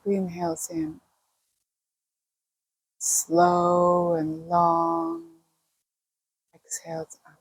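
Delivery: unhurried meditation guide with long pauses; calm, spacious breathing-and-body-awareness guidance; guided meditation. Acted. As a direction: lead listeners gently into relaxation, pausing between phrases.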